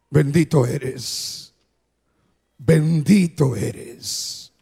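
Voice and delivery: low, slow, reverent prayer voice. Acted. Pastor praying to God before the altar call, low and reverent. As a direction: pray it unhurried and low.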